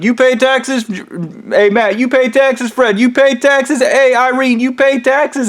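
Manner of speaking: can't believe he's hearing this; incredulous asking round. Retold or imitated. Matt asking the crowd in disbelief.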